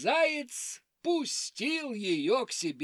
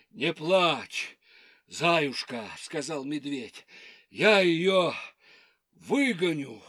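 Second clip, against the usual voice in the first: low bear voice; 5 semitones lower, 4 dB louder than usual. Acted. Bear comforts hare.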